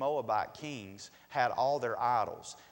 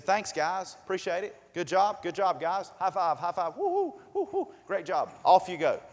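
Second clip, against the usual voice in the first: higher, louder, breezy send-off; cheery dismissal; 6 semitones higher, 8 dB louder than usual. Retold or imitated. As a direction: hype up the porters and wave them off. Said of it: "He said".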